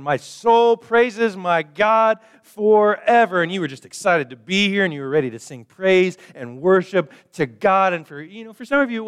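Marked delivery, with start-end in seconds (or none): 0.0-3.4 much higher and louder, slow and soaring; joyful worshipper. Retold or imitated. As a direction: sing out your praise with delight. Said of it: "and you're going".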